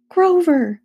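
high, loud cry of a name; alarmed cry. A narrator's reading. Boy finding his friend slumped and motionless, alarmed. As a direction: call out to a hurt friend.

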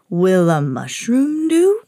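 slow sly rascal; lightly voiced rascal; rapscallion voice. A narrator's reading. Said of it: "he asked".

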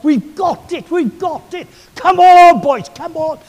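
loud, rising cheer for the team; excited fan cheering. Retold or imitated. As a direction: urge the boys on from the pub. Cheering Wales on at rugby.

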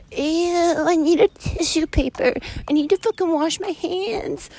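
high-pitched mimicry of fussy people; hammed-up prissy disgust; mock squeamish complainers. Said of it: "picturing all these, like, women and other people".

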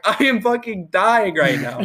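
high, slow hammed-up wail; retold melodramatic moan of pain; mock anguish. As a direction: drag it out like you're dying. Complaining in pool with stings.

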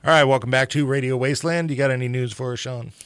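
cheesy radio host; crackly lonely radio dj. Acted. Radio show host return.

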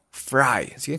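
usual speaking voice

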